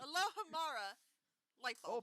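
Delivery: hammed-up spell casting. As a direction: cast it like a wizard. Mock spell in English.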